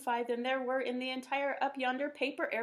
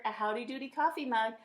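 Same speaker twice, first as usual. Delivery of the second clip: ordinary delivery, nothing marked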